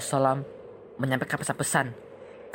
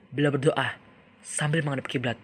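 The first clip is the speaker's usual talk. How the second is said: ordinary delivery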